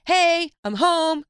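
high, slow, breezy "I'm home" call; ex-husband's casual greeting. Retold or imitated. Ex-husband walking in unannounced, breezy and loud. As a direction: sing it out like nothing happened. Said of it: "And I hear this voice".

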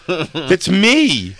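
mock awkward reveal; retold reveal. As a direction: drop the reveal with a pained lift. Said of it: "I had to go".

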